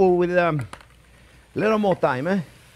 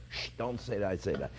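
hushed aside; 12 semitones lower, 14 dB quieter than usual. Acted. Joking aside after risqué joke.

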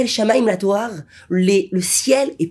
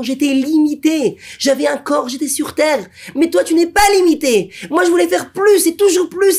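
fervent plea; 8 semitones higher than usual. Retold or imitated. Tzaddik pleading with the Master of the world, fervently. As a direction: plead with growing fervour.

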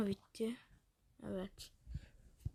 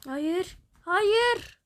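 rising scream of no, higher and louder; panicked no; 8 semitones higher, 8 dB louder than usual. Real, not acted. Zombies flood the house. (yükselen panik çığlığı)

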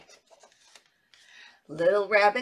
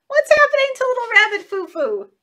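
surprise; 12 semitones higher, 5 dB louder than usual. Acted.

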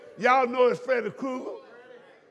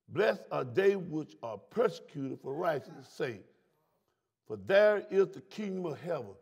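slow, low scripture reading; 6 semitones lower than usual. Shown as a demonstration. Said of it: "Listen how he said it".

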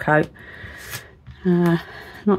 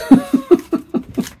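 high laugh; 4 dB louder than usual. Real, not acted. Laughs at own nails.

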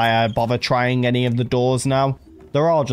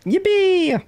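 high, bright yippee; excited cheer. Acted. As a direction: cheer it out delighted.

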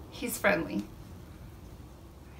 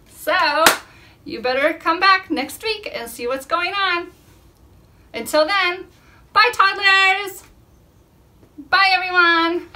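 cheerful goodbye; 7 semitones higher, 8 dB louder than usual. Real, not acted. Loud cheerful goodbye to toddlers.

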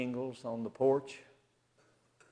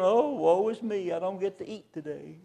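mock gloomy faster; 7 semitones higher than usual. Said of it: "don't go around".

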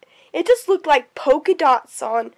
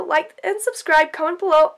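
ordinary talk

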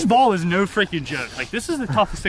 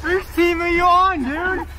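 shouting; 11 semitones higher, slower than usual. Real, not acted. Calling out on field.

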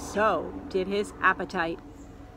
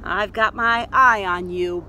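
slow, louder warning; suspicious Dot; 6 dB louder than usual. Acted. Dot warning Wolfie, slow and suspicious. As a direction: lean in and warn him slowly.

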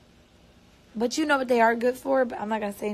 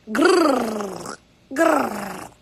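playful growl. Pretend. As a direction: growl as if the nails are claws. Growls about her nails.

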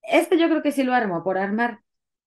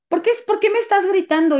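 high, louder imitation of a worked-up partner; hysterical accusation; 10 semitones higher, 5 dB louder than usual. Partner accuses her of yelling at him, hysterical and high-pitched. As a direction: retell an outraged accusation. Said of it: "Se puso histérico".

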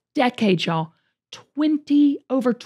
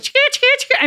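mock camera clicks; much faster than usual. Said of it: "So we're like".

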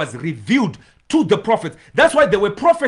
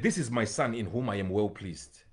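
lower, softer divine voice from the cloud; hushed, lowered voice of God naming his son; 9 semitones lower, 7 dB quieter than usual. Acted. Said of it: "And the voice came".

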